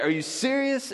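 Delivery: very high-pitched disbelieving question; incredulous. Acted. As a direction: act baffled, voice way up. Disciples' disbelief, acted out.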